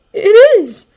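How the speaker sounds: high, loud, choked insistence; hard-to-speak, high-pitched outburst; strained with emotion. A narrator's reading. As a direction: force it out through an aching throat. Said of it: "It's hard to speak".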